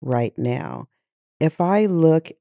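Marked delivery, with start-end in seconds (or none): 0.0-0.9 quiet anger. Real, not acted. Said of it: "I'm angry".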